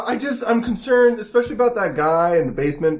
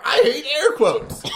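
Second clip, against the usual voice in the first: ordinary talk, nothing marked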